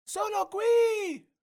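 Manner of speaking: very high, slow little voice calling from far off; a small girl calling back from afar; small distant voice. A narrator's reading. Mimì lost in the woods answering, faraway call. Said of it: "risponde una vocetta lontana".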